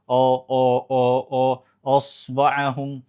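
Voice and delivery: normal talking voice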